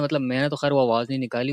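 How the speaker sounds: normal talking voice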